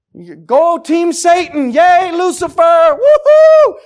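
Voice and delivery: slow, loud, high hammed-up fan cheer; sarcastic mock cheer. Preacher mocks sinners cheering Team Satan, loud and sarcastic.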